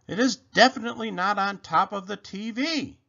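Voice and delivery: high-pitched panicky insistence; panicked rabbit. A narrator's reading. Rabbit rechecks for the missing remote, high and panicky. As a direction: pitch it up, mildly frantic.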